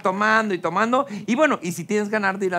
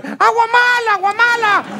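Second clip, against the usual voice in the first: imitación de grito agudo y fuerte; grito de alarma; 13 semitones higher, 6 dB louder than usual. Retold or imitated. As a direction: grita la alarma a todo pulmón, muy agudo. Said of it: "comienza a gritar". (grito exagerado de pánico)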